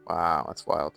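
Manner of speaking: ordinary delivery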